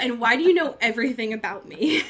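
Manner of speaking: mock Damon through laughter; quoting Damon's confusion while cracking up; laughing quote. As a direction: giggle through the bewildered question. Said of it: "and he's like".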